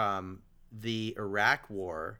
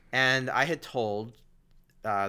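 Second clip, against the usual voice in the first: ordinary delivery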